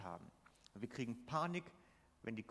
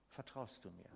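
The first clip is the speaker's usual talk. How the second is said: even softer echo; 5 semitones lower, 9 dB quieter than usual. Acted. Jesus repeats the question softly.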